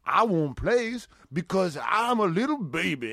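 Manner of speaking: slow whiny voice; whiny baby voice. Retold or imitated. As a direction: whine it like a big baby. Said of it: "And he's like".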